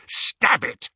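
loud, high harsh command. A narrator's reading. Harry ordering Ron to strike, harsh. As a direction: order it with force. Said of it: "Harry said harshly".

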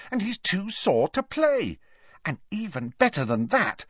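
put-on high, eager girl's voice; excited news. A narrator's reading. Ginny excitedly sharing good news.

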